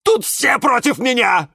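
high, loud aggrieved cry; high-pitched persecuted outburst; wailing yell. A narrator's reading. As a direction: howl that you are wronged. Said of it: "вопил Жорж".

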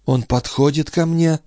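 high-pitched woman's character voice; entranced young woman. Acted. Sister in a trance describes the king approaching, high and tense.

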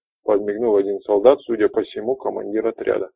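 ordinary delivery, nothing marked

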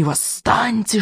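soft hiss, like snakes; hissing dragons. A narrator's reading. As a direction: hiss it like a serpent. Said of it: "шипели они".

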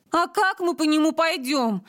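high-pitched whiny complaint; whining. A narrator's reading. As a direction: whine it like a reluctant kid. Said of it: "включила режим нытья Силантьева". (ноющим голосом)

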